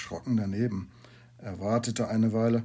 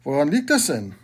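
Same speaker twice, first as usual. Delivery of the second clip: exasperated, raised-voice questioning; worked-up teacher pressing with questions; exclaiming; 4 semitones higher, 6 dB louder than usual. A narrator's reading. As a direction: demand an explanation, pitch lifted. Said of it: "rief der Justus".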